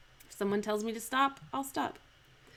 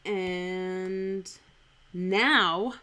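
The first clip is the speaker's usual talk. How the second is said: NOW louder after a held "and"; pause, then land hard on "now"; stress on NOW; 3 dB louder than usual. Shown as a demonstration. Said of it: "always just emphasize the word now".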